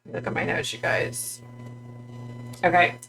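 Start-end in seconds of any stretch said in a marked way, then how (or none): 0.1-1.4 whispered aside. Real, not acted. Aside while checking notes.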